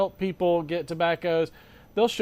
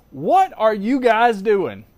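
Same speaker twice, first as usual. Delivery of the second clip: exasperated; 4 semitones higher, 6 dB louder, slower than usual. Real, not acted. Exasperated outburst.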